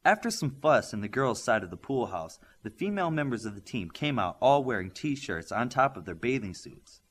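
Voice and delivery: plain reading voice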